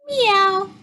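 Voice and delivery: high, drawn-out meow; high storybook cat cry; kitten meow. A narrator's reading. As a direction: imitate a kitten. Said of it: "cats and kittens say".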